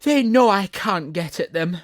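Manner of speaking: panting. Acted. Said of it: "it panted".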